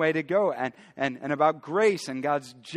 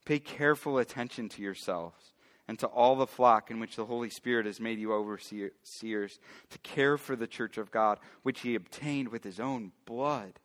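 low, quieter bible reading voice; scripture reading; 4 semitones lower, 4 dB quieter than usual. A narrator's reading. Pastor reading Acts to his church, hushed and steady. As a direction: reads the verse to the congregation, soft and measured.

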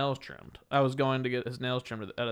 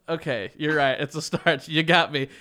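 louder fed-up mock reply; sarcastic give-in that builds louder; exasperated; 3 semitones higher, 7 dB louder than usual. She retells giving in to the clerk, louder and fed up.

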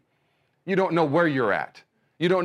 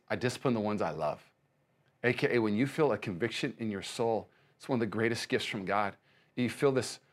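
softer, low and tender preaching; quiet reassuring sermon voice; gentle sermon; 4 semitones lower, 5 dB quieter than usual. Acted. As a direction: comfort the listener softly.

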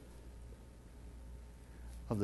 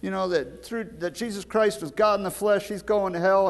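imitating the inmate; 9 semitones higher than usual. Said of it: "he says".

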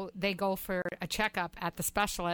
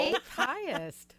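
laughing, slowly drawn out "Eighth highest"; amused disbelief; 5 semitones higher, much slower than usual. Real, not acted. The co-host echoes the life expectancy ranking, laughing and slow with disbelief. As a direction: chuckle it out, half disbelieving, very slow. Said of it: "That surprises me".

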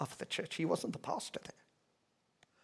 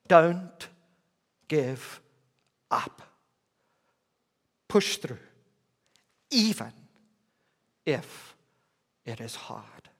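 "Don't give up" one word at a time, long pauses; emphatic exhortation; slower than usual. Real, not acted. Preacher urging the suffering to push on, slow and deliberate.

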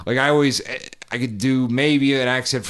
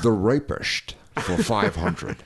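Sean Connery. Acted. Guest as quiz show contestant, Sean Connery impression. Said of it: "Hollywood Jeopardy".

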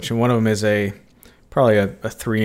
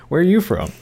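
higher-pitched imitation of a puzzled local; mock local questioning; 7 semitones higher, faster than usual. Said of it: "everybody local, it's like".

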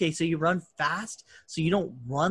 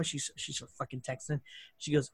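ordinary delivery

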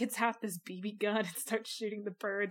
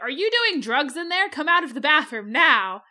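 mock demand; 3 semitones higher, 8 dB louder than usual. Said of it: "and they're like".

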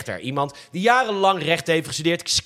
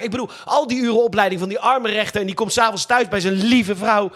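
ordinary talk

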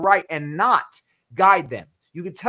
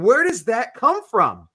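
slow, higher, louder question; acted wonder; 5 semitones higher, 5 dB louder than usual. Someone coming to ask about hope, acted wonder. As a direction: ask with wide-open acted amazement.

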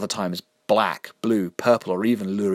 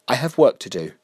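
louder, higher curt dismissal; curt angry exit; 5 semitones higher, 6 dB louder than usual. Acted. Mister Vinegar storming out on Jessica, curt and angry. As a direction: cut her off and leave.

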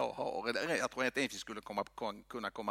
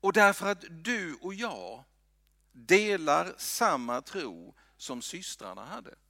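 ordinary talk

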